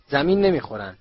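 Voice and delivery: loud shouted contradiction; shouting. A narrator's reading. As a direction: yell back in disagreement. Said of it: "رون فریاد زد".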